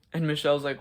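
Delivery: ordinary talk, nothing marked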